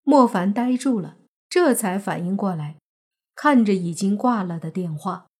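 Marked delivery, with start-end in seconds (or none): none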